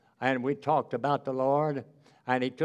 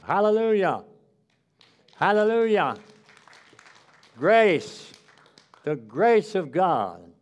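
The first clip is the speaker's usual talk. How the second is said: loud, ringing pulpit preaching; full-voiced revival preacher, stretching key words; 5 dB louder than usual. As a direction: preach it out to the congregation. Closing preaching on grace.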